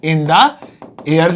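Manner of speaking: "in the air" drawn out very slowly; slow demo. Shown as a demonstration. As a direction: stretch "in the air" for the learner. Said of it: "कहां पे, हवा में". (बहुत धीमे खींचकर)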